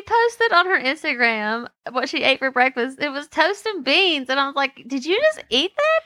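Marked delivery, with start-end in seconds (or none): 4.9-6.0 surprised. Retold or imitated. Quoting her own reaction to breakfast.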